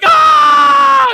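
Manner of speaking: long, high, loud scream; mock scream, held high and loud; startled scream. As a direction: imitate a startled kid screaming "aah". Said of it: "he's, like, crying".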